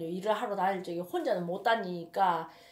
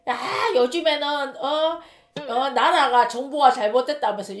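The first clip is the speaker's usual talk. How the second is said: retold grumbling; 4 semitones higher, 8 dB louder than usual. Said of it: "막 요양병원 욕을 하더라고".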